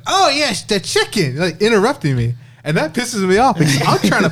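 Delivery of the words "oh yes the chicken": high-pitched, eager interruption; Owen. Retold or imitated. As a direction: butt in high, stretching it like a caricature. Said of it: "Owen's like".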